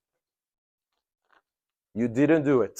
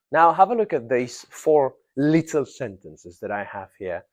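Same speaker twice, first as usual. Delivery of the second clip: ordinary delivery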